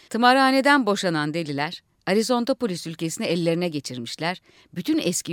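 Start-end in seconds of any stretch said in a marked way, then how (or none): none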